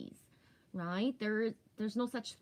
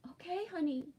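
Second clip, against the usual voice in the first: tender aside; 9 semitones higher than usual. Real, not acted. Answering child who interrupts the livestream.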